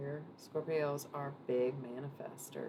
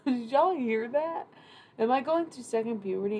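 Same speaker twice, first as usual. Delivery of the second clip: high, loud amazed questions; half-laughing disbelief; astonished; 7 semitones higher, 8 dB louder than usual. Real, not acted. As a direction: ask the audience in real shock. Shocked at own voice crack.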